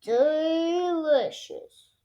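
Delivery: very slow, drawn-out relish; Daddy enjoying the pancake; savoured delicious. A narrator's reading. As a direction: savour it as if tasting. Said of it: "catches it in his mouth".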